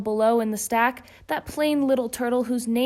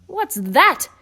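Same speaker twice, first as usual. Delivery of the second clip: louder snorting surprise; snorted question; 5 semitones higher, 6 dB louder than usual. Acted. Yertle snorts at the moon above, louder. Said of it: "snorted Yertle".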